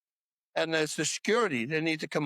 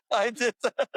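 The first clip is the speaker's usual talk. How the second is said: laughing confession; 5 semitones higher than usual. Real, not acted. Telling the bodybuilder he caused the flood, laughing. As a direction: own it while cracking up.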